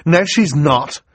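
high, loud, eager contradiction; excited. Acted. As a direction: jump in excitedly to defend her. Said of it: "Klaus said excitedly".

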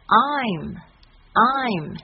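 "I'm" drawn out very slowly, open and full. Shown as a demonstration. Teacher models a full, slow I'm. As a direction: stretch "I'm" with an open mouth. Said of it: "要饱满哦".

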